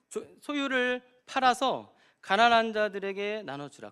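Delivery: loud, high impassioned preaching; full-force preaching. Acted. Pastor urging the congregation, loud and fervent. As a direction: drive the point home to the congregation.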